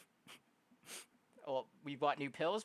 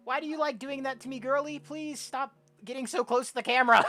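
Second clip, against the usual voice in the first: high, loud pleading protest; startled complaint; 10 semitones higher, 7 dB louder than usual. Real, not acted. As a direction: plead loudly after being spooked. Complains after jump scare.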